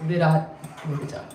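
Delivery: ordinary talk, nothing marked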